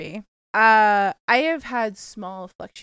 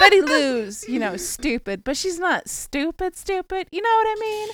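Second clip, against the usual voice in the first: put-on Southern accent; 8 semitones higher, 4 dB louder than usual. Southern character voice, rising.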